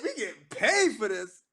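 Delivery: high, loud, drawn-out disbelief; hammed-up incredulous outburst; acted astonishment. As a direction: stretch it out in stunned amazement. Acts out amazement at being paid.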